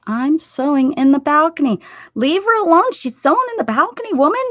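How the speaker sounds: high, loud teasing voice; playful high scold at a chatter; playful mock scolding. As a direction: shoo them off in mock outrage. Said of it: "Sewing what?".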